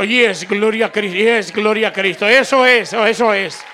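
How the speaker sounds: normal talking voice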